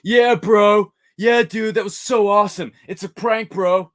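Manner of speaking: loud high dude-bro voice; frat bro. Retold or imitated. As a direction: shout it like a hyped frat boy.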